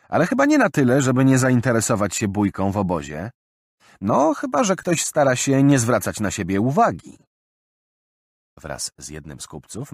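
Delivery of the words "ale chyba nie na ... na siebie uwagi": higher, louder teasing accusation; sarcastic young man. Acted. Roland mocking the thief before the crowd, sarcastic. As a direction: needle the suspect with irony.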